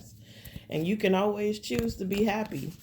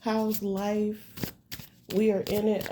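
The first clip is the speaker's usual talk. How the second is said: ordinary talk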